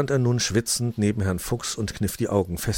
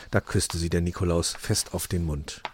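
usual speaking voice

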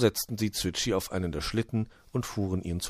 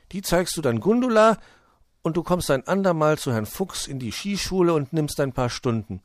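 höhere Figurenstimme, beschwichtigend; vorsichtig ratender Freund; 7 semitones higher, 4 dB louder than usual. Acted. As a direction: schlag es behutsam vor, mit gehobener Stimme. Voices Paul making a careful suggestion. (bedächtiger Vorschlag eines Freundes)

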